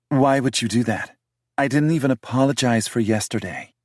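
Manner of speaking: defensive British woman voiced high; heroine's voice. Heroine's lines.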